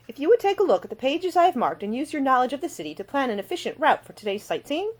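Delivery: put-on prim, indignant young lady. Ida voiced, brisk request.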